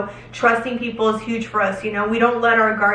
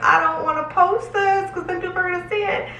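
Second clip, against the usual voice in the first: higher, put-on voice of her own doubt; self-quoted worry; 9 semitones higher than usual. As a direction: voice her inner worry, pitched up. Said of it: "I'm like".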